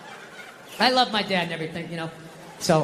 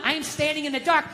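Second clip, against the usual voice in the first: ordinary talk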